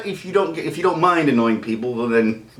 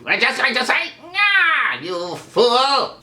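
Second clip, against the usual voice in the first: high-pitched cartoon villain voice; Skeletor; 12 semitones higher, much slower than usual. Shown as a demonstration. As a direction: sneer it as a high, theatrical villain. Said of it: "who Skeletor is".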